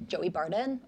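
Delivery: brisk, casual American delivery; quick American accent. Shown as a demonstration. Guest answering host in accent duel, quick American. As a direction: say it short and light, in American. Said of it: "Mine's a lot quicker than yours".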